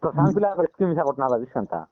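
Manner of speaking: normal talking voice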